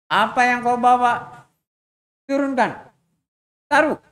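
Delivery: threatening bandit. Acted. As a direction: bark orders at the victim. (ancaman begal, keras dan pelan)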